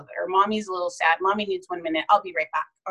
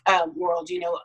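ordinary talk, nothing marked

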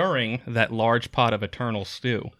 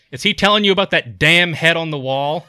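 gruff woman calling from the kitchen, higher and louder; raised, exasperated gruff female voice; 6 semitones higher, 7 dB louder than usual. Acted. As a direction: holler it gruffly like a fed-up wife. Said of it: "a gruff female voice".

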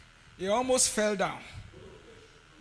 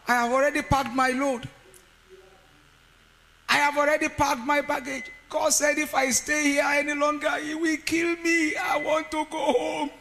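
raised, high-pitched acted pleading; urgent insistence; 6 semitones higher, 4 dB louder than usual. Guest begging his host at night to go home, urgent insistence. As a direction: insist loudly, stretching the final words.

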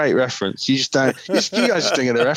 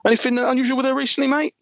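chatty mechanic, higher and fast; garage mechanic voice; 12 semitones higher, faster than usual. Retold or imitated. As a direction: play the cheerful garage mechanic asking. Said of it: "you take her down the garage".

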